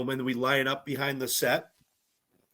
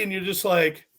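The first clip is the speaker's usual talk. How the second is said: ordinary delivery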